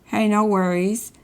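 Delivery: normal talking voice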